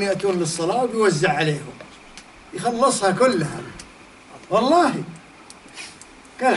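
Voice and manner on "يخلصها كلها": ordinary talk, nothing marked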